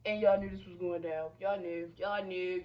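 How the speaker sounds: usual speaking voice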